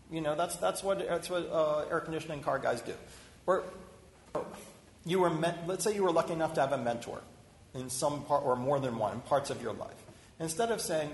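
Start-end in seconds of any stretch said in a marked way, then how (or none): none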